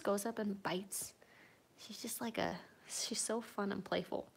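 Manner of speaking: whispered, so as not to disturb the cats. Real, not acted. Owner whispering while watching her cats play. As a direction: hush and whisper the commentary.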